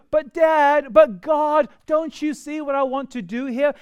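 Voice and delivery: put-on high whining "But Dad" like a child; whiny kid. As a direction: plead like a little kid. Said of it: "we sometimes sound like".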